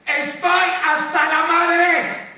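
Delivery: high, defiant rallying yell; angry shout. Acted. Anchor showing viewers what to yell, angry shout. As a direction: cry it out as if from a window. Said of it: "saquen la cabeza y griten".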